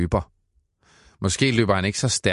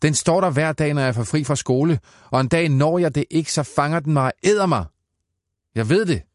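put-on agitated boy; 8 semitones higher than usual. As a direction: plead urgently so she believes him. Boy protests fearfully.

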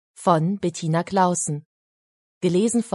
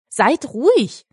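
demanding; 7 semitones higher, 6 dB louder, slower than usual. Acted. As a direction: hush the crowd firmly, louder. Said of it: "verlangte Henrik".

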